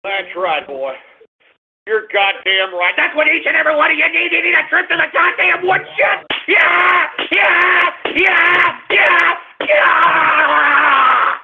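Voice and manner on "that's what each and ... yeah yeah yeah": higher, faster, building to yells; worked-up shout. Real, not acted. Radio host ranting at callers, rising to shouts. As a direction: whip yourself into a frenzy.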